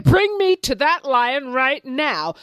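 lion ordering rabbit, slow and furious. Acted. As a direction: bellow it slowly, enraged. Lion demands again.

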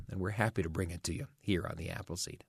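usual speaking voice

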